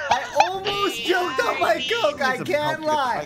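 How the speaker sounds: loud, slow, laughing yell; laughing shout. Real, not acted. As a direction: shout it while laughing hard. Laughing shout after choking.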